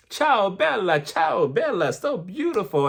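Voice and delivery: singsong mock-Italian gushing. [name] greets Jen in Italian, mock-Italian gushing. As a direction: imitate a gushing greeting in playful Italian style. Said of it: "talking to her in Italian".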